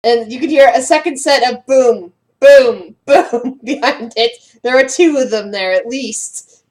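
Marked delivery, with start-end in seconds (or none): 2.4-3.0 loud single boom, like a heavy stomp; a loud booming footstep sound. Acted. Mimicking heavy approaching footsteps. 3.0-4.6 slow, stretched boom through a laugh; a slow boom breaking into laughter; drawn-out laughing boom. Acted. Mimicking heavy approaching footsteps.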